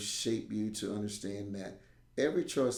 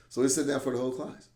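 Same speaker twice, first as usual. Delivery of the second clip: ordinary talk, nothing marked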